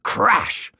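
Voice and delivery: ordinary delivery, nothing marked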